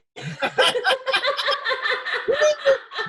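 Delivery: bursting into loud laughter; real laugh. Real, not acted. Host laughing at her own cup joke, loud laughter.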